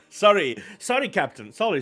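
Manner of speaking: ordinary talk, nothing marked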